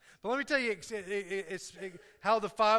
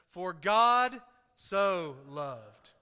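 drawn out, word by word, very slow; very slow reading; 3 semitones lower, 4 dB louder, much slower than usual. Shown as a demonstration. Pastor slowing the verse for the church, very slow. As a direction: stretch each word out to make it land. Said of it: "let's slow down just a little bit".

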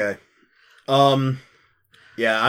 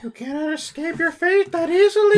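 menacing otherworldly voice. Acted. Player in character to doomed Brillock, high and menacing. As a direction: pronounce doom in a high put-on voice.

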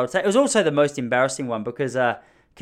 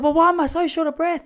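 high, loud mock-panicked wail; panic attack; 6 dB louder than usual. Stung boy asking why he's short of breath, hammed-up panic. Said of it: "You're having a panic attack".

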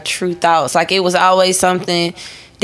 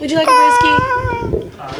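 much higher, sing-song offer of a brewski; mock waiter. Offering Glenn a drink like a fancy host, sing-song high.

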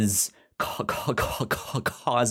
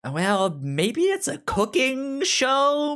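hammed-up high voice of a confused viewer; mock naive guess. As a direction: play the clueless viewer guessing wildly. Joking about misleading title.

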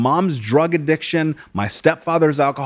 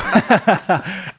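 a short, higher real laugh; 7 semitones higher than usual. Real, not acted. Guest laughs at the joke about getting canceled. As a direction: laugh it off lightly.

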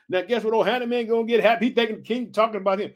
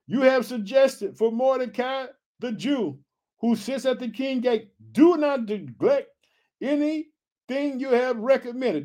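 slow, high-pitched Bible reading; scripture reading; 5 semitones higher, slower than usual. A narrator's reading. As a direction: stretch out the verse for the congregation. Reads scripture aloud.

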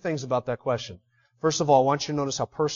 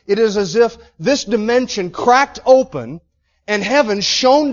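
loud, high-pitched sermon build, slowing on key words; preaching at full force; 6 semitones higher, 9 dB louder than usual. Acted. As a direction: preach it with rising fire. Preacher retells vision forcefully.

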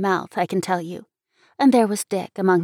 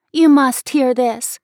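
louder, higher, drawn-out insistence; urgent cry; 5 semitones higher, 6 dB louder, slower than usual. A narrator's reading. Girl stopping Dick's refusal, crying out urgently. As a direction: cry out slowly, demanding to be heard. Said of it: "I cried".

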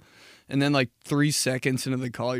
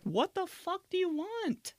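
mock outrage; 8 dB quieter than usual. Annoyed answer to old caller.

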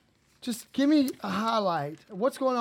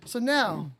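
normal talking voice

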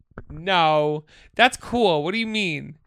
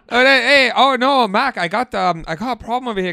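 put-on loud, higher, wobbly Minnesotan accent; Minnesota accent slipping Irish; 5 semitones higher, 7 dB louder than usual. Asking Mac for help, Minnesota accent sliding Irish. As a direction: attempt Minnesotan and drift into Irish. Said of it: "And I'm going Irish".

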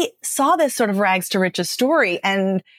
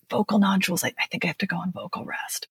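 strained, barely-there whisper through sore vocal cords; the young actor to her teacher, answering in a pained, barely audible whisper; 7 dB quieter, faster than usual. Retold or imitated. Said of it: "extremely painful, barely audible voice".

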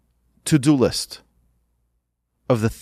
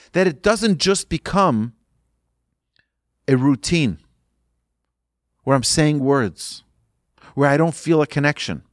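ordinary delivery

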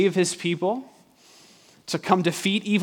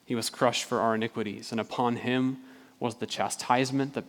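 lower, softer, measured reading with pauses; solemn scripture reading; 4 semitones lower, 4 dB quieter than usual. A narrator's reading. Preacher reads Isaiah fifty-three on the suffering servant, solemn and hushed. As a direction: read it gravely and quietly, line by line.

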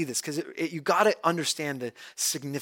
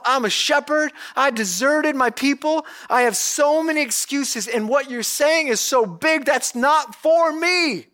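Moses making excuses; 9 semitones higher, 4 dB louder than usual. Retold or imitated. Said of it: "Moses is saying to himself".

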